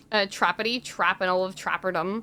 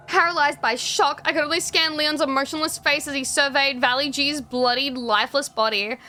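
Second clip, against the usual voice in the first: dramatic story narration; 3 semitones higher than usual. A narrator's reading.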